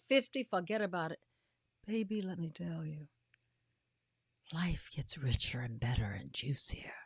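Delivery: ordinary talk, nothing marked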